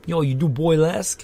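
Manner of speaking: put-on playful Boston bro accent. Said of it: "people from, like, Boston?".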